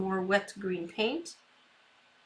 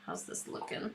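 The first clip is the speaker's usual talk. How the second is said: breathy whispered question, much softer and lower; 14 semitones lower, 14 dB quieter than usual. Real, not acted. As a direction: whisper it close to the canvas as if not to disturb. Quiet self-check while painting.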